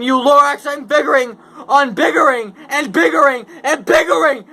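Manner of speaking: high, loud defiant shouting; angry yell. Acted. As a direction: yell back at the nagging Lorax. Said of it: "I yelled at the Lorax".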